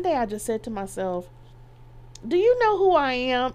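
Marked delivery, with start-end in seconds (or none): 2.3-3.5 higher and louder, full of wounded pride; prideful. Retold or imitated. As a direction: demand it like a VIP who is being ignored. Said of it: "I was prideful".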